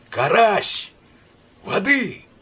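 high hoarse call, lost; hoarse, bewildered shout. A narrator's reading. Myshlaevsky calls hoarsely to Karas for water. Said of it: "сипло, растерянно крикнул".

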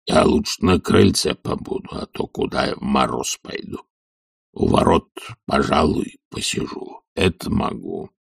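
low, soft grumble; husky old retainer trailing down; reluctant servant. A narrator's reading. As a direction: bargain sluggishly under your breath. Zakhar bargains to stay near.

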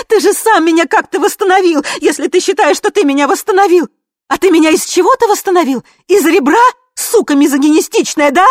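very high, loud yelling; high-pitched, full-voiced raging; screamed tirade. A narrator's reading. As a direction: scream in betrayed fury. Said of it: "орала Ви".

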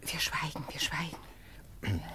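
slow, drawn-out whisper; solemn whispered promise of silence. Acted. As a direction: whisper the vow slowly.

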